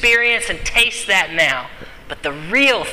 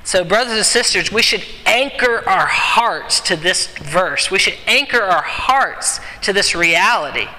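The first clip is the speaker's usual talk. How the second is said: normal talking voice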